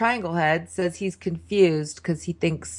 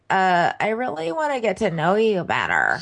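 mock nasal dating talk; pinched, nasal imitation; nasally. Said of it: "they're having a really nasally conversation".

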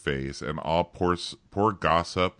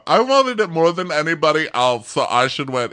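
mock whiny roommate; 9 semitones higher, 6 dB louder than usual. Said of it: "roommate quote".

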